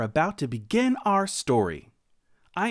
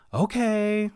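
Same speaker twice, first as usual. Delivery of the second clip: drawn-out, higher, reluctant okay; sighing agreement; 6 semitones higher than usual. A narrator's reading. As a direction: give in with a mild, acted sigh. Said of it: "Sarah said with a sigh".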